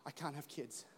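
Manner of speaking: a woman leaving the clinic in soft, hushed despair. Retold or imitated. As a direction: breathe out the bad news quietly. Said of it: "a woman was leaving a medical clinic going".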